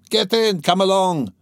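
high, urging call. A narrator's reading. Mikolka calls crowd into cart.

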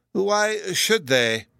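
high, very slow professor's voice; high, drawn-out absent-minded query; waking from reverie. A narrator's reading. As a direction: drag it out as if roused from a daydream. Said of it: "suddenly waking up out of a deep reverie".

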